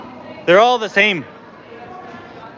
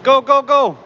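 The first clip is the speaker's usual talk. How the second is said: high, loud go calls; excited cheering; 7 semitones higher, 4 dB louder than usual. Real, not acted. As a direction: cheer them on. Cheering in race game.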